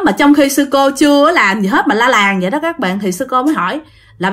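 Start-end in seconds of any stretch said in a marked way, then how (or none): none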